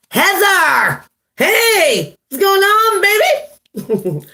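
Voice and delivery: high, delighted call-out; excited greeting. Real, not acted. Host welcoming a viewer to the stream, high and excited.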